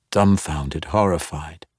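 ordinary delivery, nothing marked

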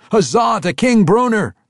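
very high, loud hail; cheering cry. Acted. The dwarves hailing King Bruner, loud cheer.

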